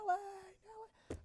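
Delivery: high, drawn-out call held back in volume; imitated yelling. Owner going door to door for the dog, soft mock holler. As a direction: mimic a far-carrying call without the force. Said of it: "So I'm yelling".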